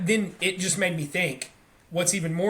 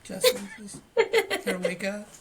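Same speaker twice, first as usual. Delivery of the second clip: "Justin" jumps far higher in a laughing sing-song; mock sing-song wake-up. Dad mocking a gentle wake-up call, high sing-song. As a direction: lilt "Justin" way up, half laughing. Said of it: "ten times and be like".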